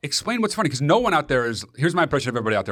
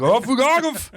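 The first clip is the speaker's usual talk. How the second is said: mock annoyed; 11 semitones higher, slower than usual. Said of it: "be like".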